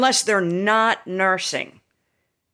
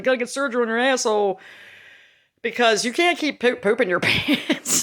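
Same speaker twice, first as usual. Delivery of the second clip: higher, fast mocking mother, laughing at the end; mother's teasing; 6 semitones higher than usual. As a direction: rattle it off like a teasing mom. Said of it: "She's like".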